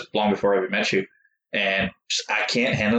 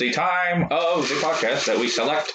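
higher, slow, stagey announcement; mock announcer; 4 semitones higher than usual. Co-host introduces the topic draw, mock announcer. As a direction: announce it slowly, word by word.

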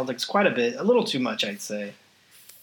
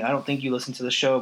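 ordinary talk, nothing marked

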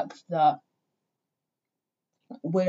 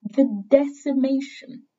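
hardcore emphasis; 7 semitones higher than usual. Real, not acted. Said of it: "How fucking hardcore does that sound?".

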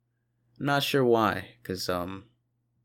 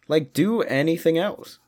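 usual speaking voice

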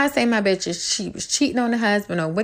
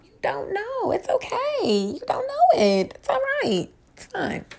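much higher, sweet mock reassurance; patronizing; 14 semitones higher than usual. Host mocking know-it-alls, high sugary condescension. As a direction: pat them on the head with your voice.

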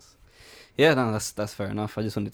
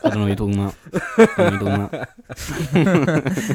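laughing; 6 semitones higher, 9 dB louder than usual. Real, not acted. Playful denial while laughing.

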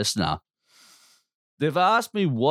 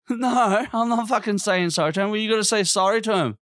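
much higher, mocking the order back; high mocking refusal; 10 semitones higher than usual. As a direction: toss the supervisor's words back in a high mock voice. Refusing apology, mocking supervisor.

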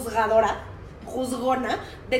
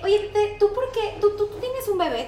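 lightly voiced small boy, pitched up and stammering; curious boy; 8 semitones higher than usual. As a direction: ask it with a kid's blunt curiosity. Boy questioning friend's friend.

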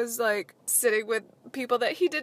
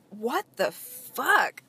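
shocked; 3 semitones higher, 3 dB louder, much slower than usual. Retold or imitated. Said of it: "And I was like".

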